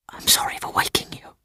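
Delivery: soft unvoiced whisper. A narrator's reading. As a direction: whisper it so no one hears. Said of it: "he whispered".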